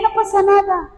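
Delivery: high, offhand imitation of unconcern; mock nonchalance. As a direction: brush it off as no matter. Voices the unconcerned attitude.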